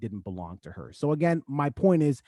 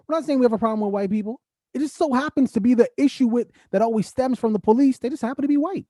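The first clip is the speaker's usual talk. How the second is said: impassioned; 8 semitones higher, 3 dB louder, faster than usual. Real, not acted. Impassioned plea on police.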